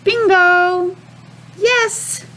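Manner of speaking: high happy cheer; excited cheer. Real, not acted. Teacher cheers right answer.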